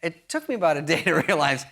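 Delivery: ordinary talk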